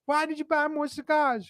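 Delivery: high-pitched nagging wife; whiny wife impression. Husband quoting annoyed wife.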